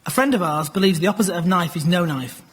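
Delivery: normal talking voice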